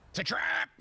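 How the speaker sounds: trap call. Pretend. Meme trap call again.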